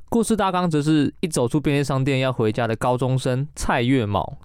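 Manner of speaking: ordinary talk, nothing marked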